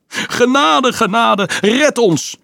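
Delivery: high, loud panicked pleading; begging for mercy. Acted. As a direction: plead for your life under the devil. Soldier pinned by devil. (hoog en luid smekend om genade)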